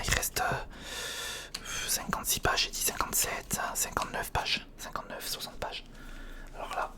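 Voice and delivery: usual speaking voice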